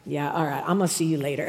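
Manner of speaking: low, soft husband voice; imitating a husband bowing out; retreating husband. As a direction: back out of it quietly. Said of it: "he's like".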